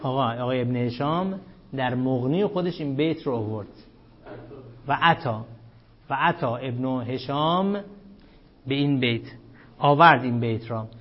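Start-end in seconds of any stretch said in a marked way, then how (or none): none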